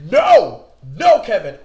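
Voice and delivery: high, loud inner scream; mock panicked cry at himself; inner alarm. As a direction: yell a warning to yourself, loud and high. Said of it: "my mindset was like".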